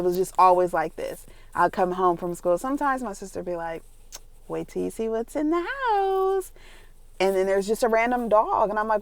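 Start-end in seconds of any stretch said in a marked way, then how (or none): 4.5-6.5 high singsong; singsong teasing announcement. Retold or imitated. Sister announcing a surprise at home, singsong teasing. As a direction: sing out a surprise, stretched at the end.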